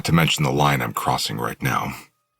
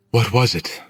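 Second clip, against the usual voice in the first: put-on higher young woman voice; Willow; 10 semitones higher, 5 dB louder than usual. A narrator's reading. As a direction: lift into a girl's voice, firm question. Willow, possessed girl, questions narrator.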